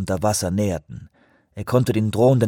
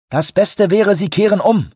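over walkie talkie; 10 semitones higher, 5 dB louder than usual. A narrator's reading. Radio advice.

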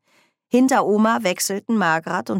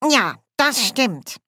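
slow, high-pitched dwarf voice; relieved dwarf; 6 semitones higher, slower than usual. A narrator's reading. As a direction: confirm slowly with relief, as the dwarf. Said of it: "Ein erleichtertes Lächeln".

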